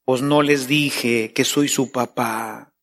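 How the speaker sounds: casual fatherly God; God speaking, relaxed. Pretend. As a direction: shrug it off as a laid-back dad. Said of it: "Es decir".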